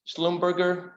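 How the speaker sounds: ordinary talk, nothing marked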